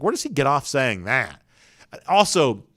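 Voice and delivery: ordinary talk, nothing marked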